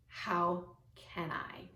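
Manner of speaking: whisper. Shown as a demonstration. Coach offers the new phrase.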